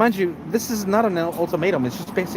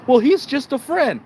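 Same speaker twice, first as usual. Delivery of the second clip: very high, louder mock girlfriend voice; girlfriend excuse; 10 semitones higher, 4 dB louder than usual. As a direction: squeak it out like the girlfriend.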